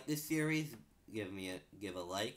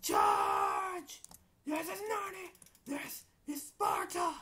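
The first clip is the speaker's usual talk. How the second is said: high shouted movie battle cries; battle cry; 4 dB louder, slower than usual. Pretend. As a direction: roar it like charging into battle. Meme battle cries in fight.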